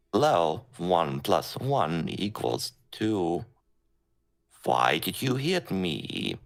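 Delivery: slow, choppy robot inflection; speak and spell voice. Shown as a demonstration. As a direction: klink als een speak and spell. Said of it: "so it's this".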